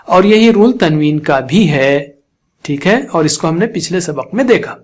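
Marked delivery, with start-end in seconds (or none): none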